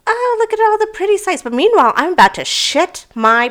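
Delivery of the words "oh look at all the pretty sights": high, sing-out sightseer cheer; feigned delight. Retold or imitated. Parasailer hiding panic from partner, high feigned delight. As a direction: put on carefree wonder, pitched way up. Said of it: "can't act as though I'm freaking out".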